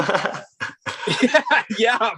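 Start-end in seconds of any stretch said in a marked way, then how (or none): 1.2-2.1 laughing yes, pitched up high. Real, not acted. Admitting the hungover trust fall, laughing. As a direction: laughs it out, a bit sheepish.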